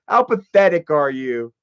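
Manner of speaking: ordinary talk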